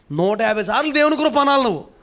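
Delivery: high, loud insistence; emphatic instruction. Real, not acted. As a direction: drive the order home. (గొంతు పెంచి గట్టిగా నొక్కి చెప్పడం)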